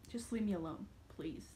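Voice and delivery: soft, breathless whisper; worn-out whispered plea to be left alone. Retold or imitated. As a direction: whisper it like you've given up.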